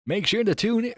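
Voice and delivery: high upbeat radio plug. Shown as a demonstration. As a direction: hype it like a radio DJ. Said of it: "You got the radio voice".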